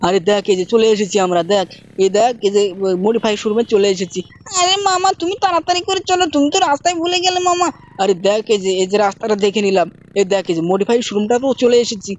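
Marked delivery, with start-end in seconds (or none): 4.6-7.7 put-on high, pleading little-boy voice; Shinchan child voice. Shinchan nagging his uncle, high childish voice. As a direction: pitch way up and whine at mama like a small kid.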